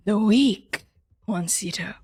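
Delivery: slow, deliberate godlike patriarch, falling to a whisper; imperious divine father. Acted. Divine father testing his son on weakness, imperious and slow. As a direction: lay down the law slowly, then hush the question.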